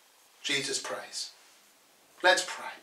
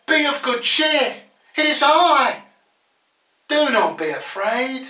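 raised, high-pitched call across to frightened followers; calling out reassurance; 5 semitones higher than usual. Acted. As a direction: call it out high, then ease off. Jesus calling out to disciples.

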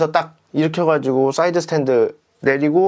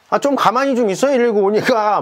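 urgent call; 7 semitones higher than usual. Retold or imitated. Urging injured rider to stay still.

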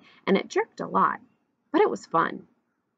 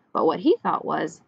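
ordinary delivery, nothing marked

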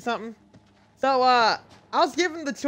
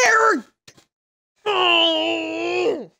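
sudden high, loud oh; startled exclamation; surprised cry; 6 semitones higher, 6 dB louder, much slower than usual. Real, not acted. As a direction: cry out as it dawns on you. Sudden realization.

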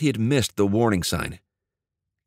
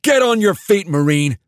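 a full bellow, much higher and louder; 10 semitones higher, 6 dB louder than usual. A narrator's reading. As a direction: roar the order at the sergeant. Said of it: "Andy bellowed".

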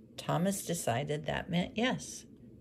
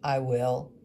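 louder, curt reply; gruff woodcarver; 3 semitones lower, 8 dB louder than usual. Acted. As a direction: answer shortly. Woodcarver agreeing curtly.

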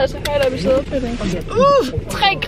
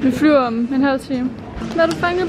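speaking behind surgical mask; muffled by mask; through a face mask; 2 semitones lower, faster than usual. Real, not acted. Seen 1: Man on screen wears a surgical mask over his mouth throughout.

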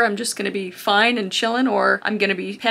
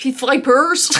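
louder bro-style "Pit vipers"; Chad voice; 2 semitones higher, 5 dB louder, slower than usual. Shown as a demonstration. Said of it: "I just immediately turn into Chad".

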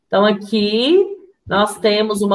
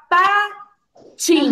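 "patins" broken slowly into two syllables; split into syllables; 5 semitones higher than usual. Shown as a demonstration. Teacher asks how to separate the word, "patins" split by syllable. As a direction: say "patins" with a clear gap between pa and tins. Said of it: "Como que eu vou separar".

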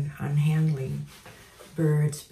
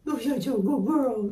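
voiced, higher praise to the bird; high sweet praise; 6 semitones higher than usual. Real, not acted. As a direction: lift it up in a sweet coo.

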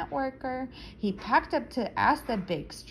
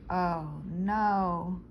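usual speaking voice

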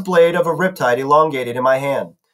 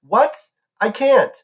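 high, startled protest; pitched-up disbelief; incredulous refusal; 7 semitones higher, slower than usual. A narrator's reading. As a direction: recoil from the idea. Percy refuses the task in disbelief.

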